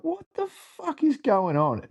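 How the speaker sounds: high, slow, stunned disbelief. Retold or imitated. Retelling seeing star players, stunned high disbelief. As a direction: gasp it out, unable to believe who's there.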